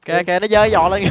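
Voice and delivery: very loud, high cry breaking into laughter; amused shouting. Real, not acted. Player points out the creature raising its leg, laughing shout. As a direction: yell it out, then burst out laughing.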